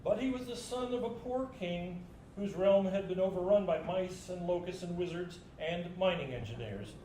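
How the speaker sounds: ordinary delivery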